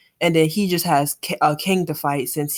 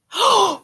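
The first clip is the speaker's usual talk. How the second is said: sharp gasp; 13 semitones higher, 5 dB louder than usual. Real, not acted. Fight scene reaction.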